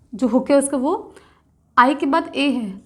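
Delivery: ordinary talk, nothing marked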